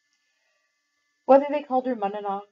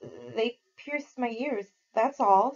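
plain reading voice

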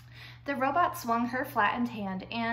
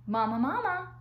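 high, loud peeping gosling call; baby gosling voice; 5 semitones higher, 4 dB louder than usual. Acted. Gosling calls for its mother.

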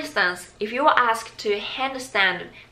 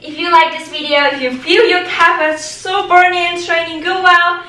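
upbeat outro; 8 semitones higher, 11 dB louder than usual. Acted.

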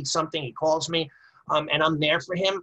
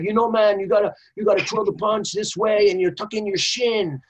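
mock Spanish accent; 4 semitones higher than usual. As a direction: slip into a Spanish accent like a dojo teacher. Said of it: "I even taught in a Spanish accent".

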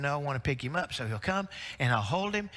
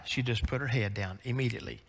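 ordinary talk, nothing marked

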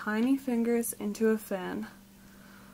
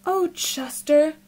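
louder, higher, slow exclamation; crying out; 5 semitones higher, 6 dB louder, slower than usual. A narrator's reading. Mother answering her upset son, a dismayed cry. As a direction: call out to a hurt child, drawn out. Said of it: "cried Missus Raccoon".

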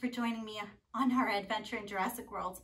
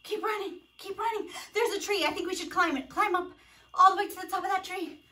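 higher-pitched playful urging; lightly frantic coaching; pretend urgency; 6 semitones higher than usual. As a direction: spur the children on through a make-believe escape. Pretend escape up a tree.

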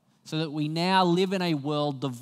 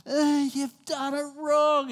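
high, drawn-out mock tantrum wail; imitated child's shouting; 12 semitones higher, 5 dB louder, slower than usual. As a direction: shout it like an upset little kid, much higher and louder. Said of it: "Evie starts shouting".

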